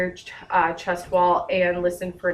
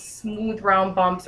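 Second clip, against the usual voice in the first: ordinary delivery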